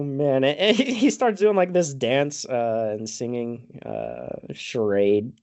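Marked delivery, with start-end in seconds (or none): none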